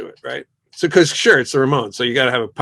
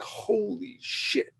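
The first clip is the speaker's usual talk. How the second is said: stunned awe; 7 semitones higher, 12 dB quieter, much slower than usual. Retold or imitated. Producer hears weird intro.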